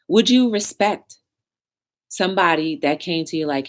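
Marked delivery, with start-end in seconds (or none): none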